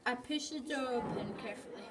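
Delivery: plain reading voice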